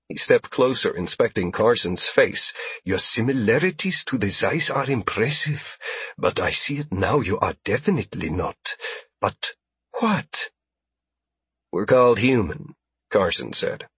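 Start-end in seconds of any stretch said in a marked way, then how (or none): 2.8-10.5 soft fawning alien voice; smooth-talking alien merchant; ingratiating alien trader. A narrator's reading. Alien inspecting Carson.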